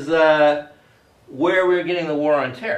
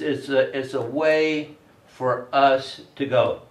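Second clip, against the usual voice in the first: normal talking voice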